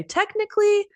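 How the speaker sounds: correcting someone smugly; know-it-all correcting a friend; condescending. Shown as a demonstration. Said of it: "I'm being a little condescending".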